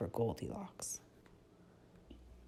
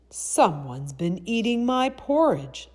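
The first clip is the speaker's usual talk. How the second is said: big loud bear voice, pitched up in outrage; loud, gruff storybook bear; rough, gruff Papa Bear; 7 semitones higher, 10 dB louder than usual. A narrator's reading. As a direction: boom it out as the gruff father bear. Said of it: "in his rough, gruff voice".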